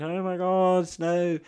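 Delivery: high-pitched mimicry of excited children; excited kids. Kids spotting snow on the mountain, mock excitement. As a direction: squeal it like kids, high and thrilled. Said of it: "The kids are like".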